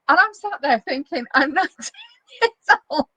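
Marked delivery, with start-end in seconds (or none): 1.3-3.1 laughing. Real, not acted. Laughing while retelling her thoughts.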